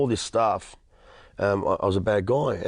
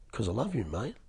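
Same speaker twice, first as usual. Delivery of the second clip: soft, sincere and much quieter; with conviction; 9 dB quieter than usual. Retold or imitated. The boss's wife tells the angry newcomer she loves him, softly sincere. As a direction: say it softly and mean every word. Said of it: "she said it with such conviction".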